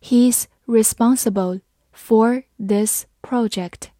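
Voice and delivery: slow, careful read-aloud; slow teacher demo. Shown as a demonstration. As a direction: read it slowly and clearly. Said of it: "好，慢慢来读".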